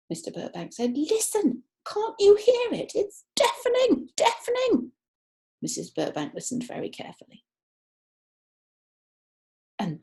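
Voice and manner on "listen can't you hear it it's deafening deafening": much higher, loud pleading cry; insistent. Acted. Mr Burbank begs his wife to hear the deafening noise, high and loud. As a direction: urge her to hear the din.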